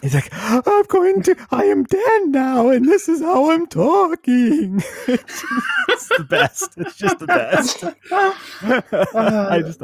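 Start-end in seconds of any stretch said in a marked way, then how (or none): 0.3-4.8 much higher, slow mocking of a croaky friend; teasing Dan's sick voice, slow and much higher; imitating sick voice. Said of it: "it's haunted sick voice". 5.0-9.8 laughing hard. Real, not acted. Cracking up at friend's voice.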